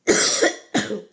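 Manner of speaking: loud real cough; coughing fit. Real, not acted. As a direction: cough hard, out of breath. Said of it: "J'ai une quinte de tout".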